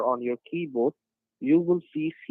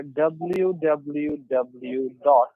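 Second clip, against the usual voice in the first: slow, letter by letter; dictating; much slower than usual. Real, not acted. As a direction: spell it out slowly over the phone. Spelling web address slowly on phone.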